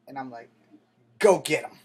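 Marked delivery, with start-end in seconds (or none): 1.2-1.8 loud, sharp dare to go get him; shouted dare. Retold or imitated. To the old man about his nephew, a loud challenge. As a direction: bark it out, done with talking.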